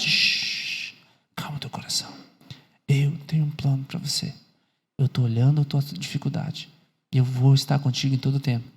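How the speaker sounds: low, hushed, soothing reassurance; speaking very softly. Acted. God promising to stay with a troubled listener, very quiet voice. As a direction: shush gently, then speak soft and low. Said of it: "Deus tá falando bem baixinho".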